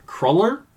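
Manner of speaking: Cruller tried out as "CRULL-er", rising high; puzzled Cruller with short "uh", not "oo"; questioning pronunciation. Shown as a demonstration. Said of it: "Why is it Cruller?".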